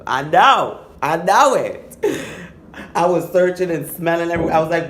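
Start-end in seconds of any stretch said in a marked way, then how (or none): 0.1-2.0 loud, high insistence; insistent. Retold or imitated. Insisting to the hookup she's clean, loud and high. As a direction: swear you know yourself, loud and high.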